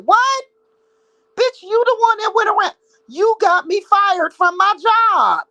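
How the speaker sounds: high, loud outraged disbelief. Real, not acted. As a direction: nyaris menjerit tak percaya, lebih tinggi dan lebih keras.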